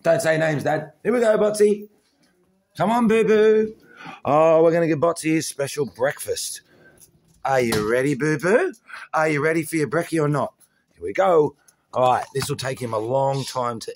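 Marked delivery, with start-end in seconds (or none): 0.0-11.6 high, loud coaxing voice; excited dog talk. Real, not acted. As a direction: rev the dog up for breakfast. Excited baby talk serving breakfast.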